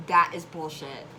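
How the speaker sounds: loud, furious retort; angry. Retold or imitated. Telling off the Girl Scout leader, loud and furious. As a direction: spit it out in outrage. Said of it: "I lost my mind. I was so pissed".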